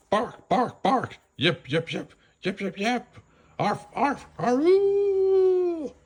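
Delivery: high barks, yips, then a howl; barking and yipping. Acted. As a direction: bark and howl like dogs calling. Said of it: "howled into the night".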